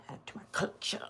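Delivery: dry whispered aside. Real, not acted. Angler whispers about catching another boot. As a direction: whisper it wryly to yourself.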